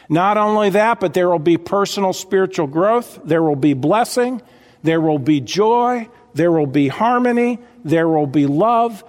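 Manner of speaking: ordinary talk, nothing marked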